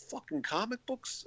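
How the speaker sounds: high, hushed mock disbelief; incredulous. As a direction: imitate a baffled man questioning it. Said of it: "he can't believe it".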